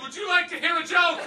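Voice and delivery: high, loud dopey question; drugged patient offering a joke; loopy joke offer. Retold or imitated. Comic to panicked mom, drug-addled. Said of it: "And I said".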